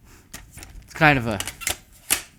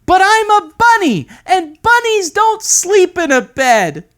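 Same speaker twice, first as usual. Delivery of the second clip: put-on high, loud protesting bunny kid; boy protesting bedtime as a bunny; pleading kid voice; 11 dB louder than usual. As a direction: whine your way out of bedtime.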